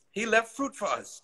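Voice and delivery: high-pitched, acted amazement; Chi Chi amazed. A narrator's reading. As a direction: marvel at it, voice lifted high. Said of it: "said in amazement".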